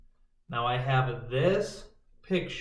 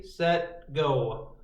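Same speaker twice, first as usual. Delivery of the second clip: ordinary talk, nothing marked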